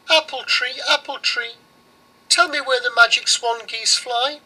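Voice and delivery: put-on high pleading little girl; girl begging the oven, voiced high; girl's voice. Girl asks the oven for help, high and earnest.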